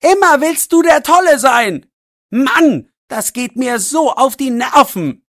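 very high, loud angry yell; furious shouting. A narrator's reading. Max yelling at Jannik, furious. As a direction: let all the anger out. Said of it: "Er ist richtig sauer".

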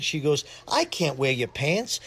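ordinary delivery

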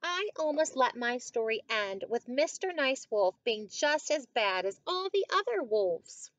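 put-on story reading to children. A narrator's reading. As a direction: read it out clearly for young listeners. Reads a picture book to children.

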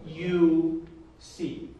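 very slow, each word set apart. Shown as a demonstration. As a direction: lay the words out one at a time. Teacher spells out word order.

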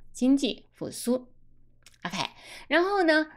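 normal talking voice

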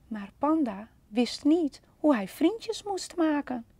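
ordinary delivery, nothing marked

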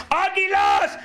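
high, loud cry; team-cheer shout. Acted. Father mocking the América shirt, shouted cheer. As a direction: shout it like a fan.

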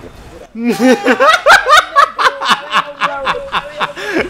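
high, loud cackling laughter. Real, not acted. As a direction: burst out laughing. Laughing at friend falling.

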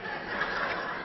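soft airy chuckle without voice; breathy laugh. Real, not acted. Preacher chuckling at his own punchline, soft breathy laugh. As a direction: let a quiet laugh slip out.

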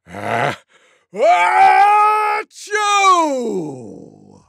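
loudest sneeze. A narrator's reading. Tiger outdoing everyone, enormous mock sneeze. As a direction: let a mock sneeze rip and trail down. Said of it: "gave the loudest sneeze you could ever imagine".

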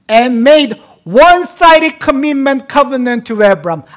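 full-force preaching. Acted. Pastor proclaiming God's one-sided covenant, booming. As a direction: preach it out with fervor.

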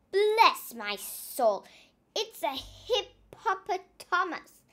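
loud, slow amazed exclamation; hearty surprised shout; astonished shout. Acted. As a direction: marvel out loud at the beast. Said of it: "he shouted".

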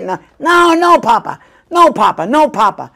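imitating a small grandson begging Papa to stop; grandson pleading. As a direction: whine no, no, no Papa like a little kid. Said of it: "he's like my grandson".

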